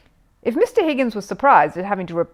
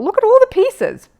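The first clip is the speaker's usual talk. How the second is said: excited; 14 semitones higher, 9 dB louder than usual. Acted. Jonathan marvels at the pieces.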